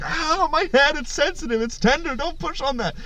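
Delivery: very high, softening mock whimper; pained whining. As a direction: plead as the sore-headed eagle. Eagle pained as his head is pushed.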